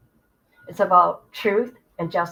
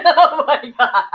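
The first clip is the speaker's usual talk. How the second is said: high, loud laugh breaking into the words; real burst of helpless laughter; 6 semitones higher, 9 dB louder than usual. Real, not acted. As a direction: say it while cracking up. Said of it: "I'm laughing".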